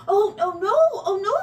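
high, loud dismay; alarmed protest. A narrator's reading. Mouse objects to patching his hole, dismayed. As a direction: protest quickly.

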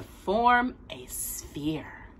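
slow breathy hush for the reveal; whispered storybook moment; storyteller whisper. A narrator's reading. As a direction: drop to a whisper, slowly. Dramatic hush in story reading.

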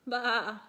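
drawn-out lamb "baa"; lamb's bleat. A narrator's reading. Scared lamb waiting for the shepherd, bleating. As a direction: imitate a waiting little lamb. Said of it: "he said".

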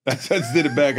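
ordinary delivery

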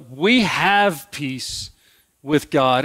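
very slow, deliberate we have peace; slow emphasis. Shown as a demonstration. Preacher stressing Paul's words to congregation, very slow. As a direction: spell out each word slowly. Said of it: "Paul says".

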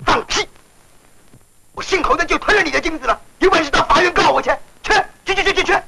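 high, defiant angry outburst; angry shouting. Acted. Landlord defying the cheated tenant, angry shouting. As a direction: pitch it up and throw them out.

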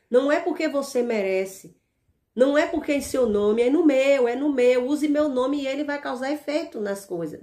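normal talking voice

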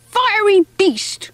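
high, indignant cry; angry name-calling. A narrator's reading. Mouse cursing the speedboat that drags him, angry. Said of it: "called it".

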